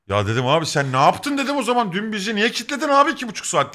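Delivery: high-pitched mock outrage; retold complaint climbing high in disbelief; indignant protest. Customer to shopkeeper who wasted his time, indignant retold protest. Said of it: "dedim ben aynen bu şekilde".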